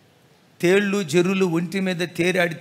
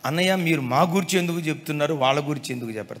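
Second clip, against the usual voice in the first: quick, rushed grumbling protest; fast imitated objection; mock complaint; 4 semitones lower, faster than usual. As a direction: rattle off the women's complaint. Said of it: "కోపంగా చూడొద్దు".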